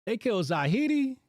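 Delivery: can't believe the news; stunned disbelief. Real, not acted. Rereads shocking headline.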